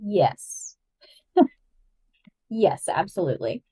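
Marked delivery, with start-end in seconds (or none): none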